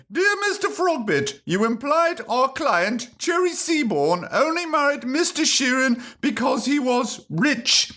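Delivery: high-pitched mock lawyer voice; lawyers' letter. Cherry's lawyers write to Mister Frogbit, high mock-lawyer voice. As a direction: read out the complaint as a high-voiced solicitor. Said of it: "her lawyers have said".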